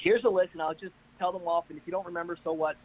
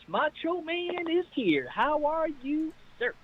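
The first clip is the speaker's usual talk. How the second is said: slow, high drawling wrestler growl; Macho Man voice; 10 semitones higher, much slower than usual. Pretend. Host greeting his co-host in a Macho Man promo voice.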